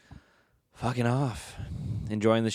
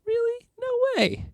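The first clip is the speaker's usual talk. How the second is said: very high, louder squeal of disbelief; mock disbelief; 5 dB louder than usual. At his funeral, a guest reacts with very high surprise. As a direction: gasp it like a shocked gossip.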